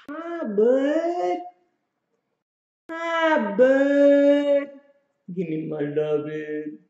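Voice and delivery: very high, half-sung cooing at the dog. Real, not acted. Cuddling and praising his dog.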